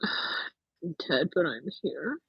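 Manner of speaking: ordinary talk, nothing marked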